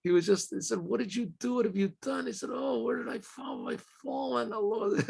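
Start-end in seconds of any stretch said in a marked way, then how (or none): 0.8-2.3 higher and softer, pained disbelief; grieving reproach. Retold or imitated. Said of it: "he starts to weep". 2.5-5.0 higher and softer, a crushed moan; anguished lament. Retold or imitated. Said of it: "He just couldn't speak".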